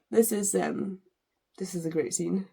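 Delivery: ordinary talk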